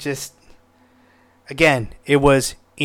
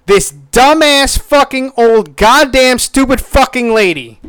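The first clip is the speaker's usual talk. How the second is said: all caps; 9 semitones higher, 6 dB louder than usual. Retold or imitated. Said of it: "what did I say again?".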